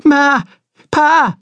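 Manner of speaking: put-on high, loud boy's scream; terrified scream. A narrator's reading. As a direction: scream out for your parents in fear. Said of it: "he screamed back".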